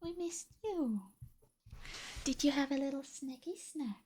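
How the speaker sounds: high, soft cooing pet voice; baby talk to a pet. Retold or imitated. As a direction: coo at the pet sweetly. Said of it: "I'm always like".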